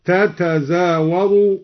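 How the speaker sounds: slow, separated تتزاور as "ta-ta-zawaru"; careful "tatazawaru" for تتزاور, nothing merged; unmerged original form. Shown as a demonstration. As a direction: sound both ta's in تتزاور, slowly. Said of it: "وأصلها".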